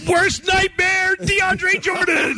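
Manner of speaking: high, slow, drawn-out arena announcer call; stadium announcer. Pretend. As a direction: stretch every word like a hype announcer. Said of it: "I would have done something like".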